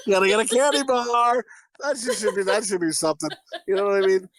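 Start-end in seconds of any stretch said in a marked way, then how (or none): 0.1-1.4 laughing quote. Retold or imitated. Quoting while laughing.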